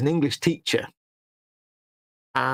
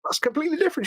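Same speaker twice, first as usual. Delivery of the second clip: high-pitched mock astonishment; retold amazement; 14 semitones higher, faster than usual. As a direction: react in high-pitched disbelief, retelling it. Retold amazed reaction to accents.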